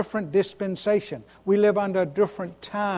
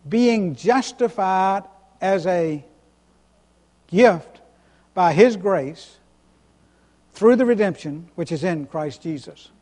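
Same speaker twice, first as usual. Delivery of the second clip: slow, weighted reading with JUSTIFIED, GIFT and GRACE each set apart; emphasizing every word. Real, not acted. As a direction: read scripture in short deliberate chunks, leaning on "gift" and "His grace". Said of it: "to emphasize every word".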